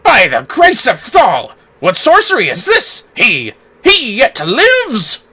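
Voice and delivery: slow, theatrical gasp of wonder; mock astonishment. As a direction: ham up a stunned nobleman. Voices character's astonishment.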